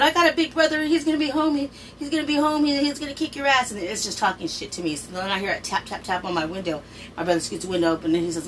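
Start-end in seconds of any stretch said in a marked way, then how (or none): 0.0-3.7 high-pitched, panicky warning; frightened threat. Retold or imitated. Scared girl warning the voice in her room, high and panicky. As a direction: bluff bravely while terrified.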